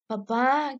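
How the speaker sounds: ordinary delivery, nothing marked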